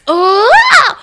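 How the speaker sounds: loud high disgusted groan; a real squeal of revulsion; annoyed ugh. Real, not acted. Disgust at fingernail torture.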